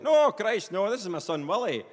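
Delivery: cheerily dismissive doctor; breezy surgeon dad. Acted. Surgeon reassures puzzled patient, acted.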